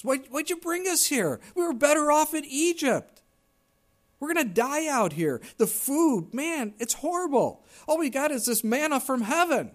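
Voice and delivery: high, whining grumble about the desert food; whiny complaining. Retold or imitated. Preacher voicing the Israelites grumbling to Moses, high whiny complaint.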